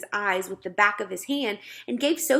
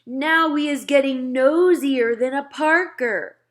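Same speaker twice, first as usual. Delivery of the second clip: a hint of a big giant, louder; gentle giant grumbling, a touch louder; lightly voiced BFG; 5 semitones higher, 8 dB louder than usual. Giant teasing nosy Sophie, lightly voiced grumble. BFG's voice in story.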